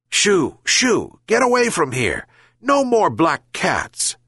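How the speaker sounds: high, angry shooing. Acted. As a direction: chase it off angrily. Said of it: "angrily said the old fisherman".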